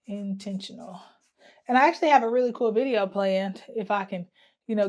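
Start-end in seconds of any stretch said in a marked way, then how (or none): 0.1-1.0 muttered to herself. Real, not acted. Muttering while writing it down.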